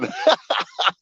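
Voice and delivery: high, quick giggling laugh; short high-pitched cackle; burst of laughter. Real, not acted. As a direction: break up laughing mid-story. Laughter breaks into the fight story.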